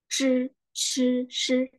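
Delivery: "Ch" and "sh" with lips pushed forward; pouted lips. Shown as a demonstration. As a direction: pout through "Ch, ch, sh", held high. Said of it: "monyong, ya kan?".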